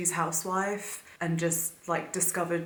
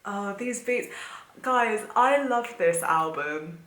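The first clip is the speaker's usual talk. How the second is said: louder, slow, drawn-out love for the album; gushing to viewers; 3 semitones higher, 6 dB louder, slower than usual. Real, not acted. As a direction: pour it all out to the guys watching.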